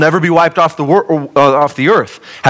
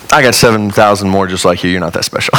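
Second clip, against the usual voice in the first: a hint of a dry, deadpan God, lower; lightly voiced God brushing him off, lower; God's reply; 7 semitones lower than usual. God answering Elijah's tantrum, dry lower deadpan. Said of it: "And God's response is".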